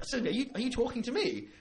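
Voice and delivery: a hint of Natalie turning round; Natalie voiced. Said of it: "the Nat stopped and went".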